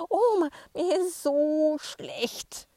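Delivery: slow, high, loud whimpering wail; whining complaint. Acted. Sick boy whines to grandma, slow and high. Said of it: "jammert er".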